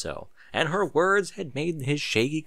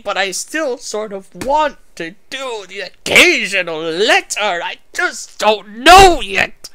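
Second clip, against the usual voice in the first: higher, louder, slow and mocking, hamming up the author's note; 8 semitones higher, 8 dB louder, slower than usual. Reader mocks the fic author's closing note, in a hammed-up sneer. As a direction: parody the earnest fanfic author, dragging each word out.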